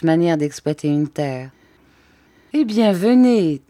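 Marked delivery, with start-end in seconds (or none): 2.5-3.6 higher, louder, eager call; gladly calls the visitor to come along; joyful. A narrator's reading. Count joyfully invites the narrator, higher and louder. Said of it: "dit-il joyeusement".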